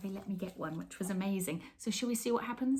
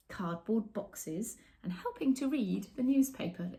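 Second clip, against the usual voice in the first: story narration; 4 semitones higher, slower than usual. A narrator's reading. Presenter reading a cat picture book to children, expressive storytelling. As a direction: read it like bedtime for little ones.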